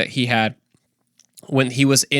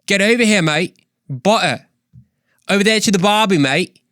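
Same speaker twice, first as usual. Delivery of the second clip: loud, high Australian accent; 7 semitones higher, 3 dB louder than usual. Shown as a demonstration. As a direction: belt it out in an Aussie accent. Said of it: "working my Australian accent".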